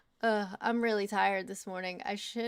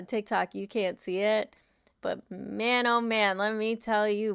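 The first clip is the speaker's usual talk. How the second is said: ordinary delivery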